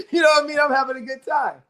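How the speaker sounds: high, loud words coming out of a laugh. Real, not acted. Telling about a good time at graduation, laughing. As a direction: talk while still cracking up.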